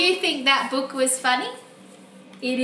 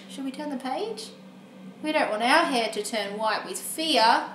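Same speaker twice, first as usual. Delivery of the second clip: ordinary delivery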